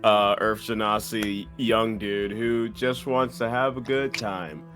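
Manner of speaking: normal talking voice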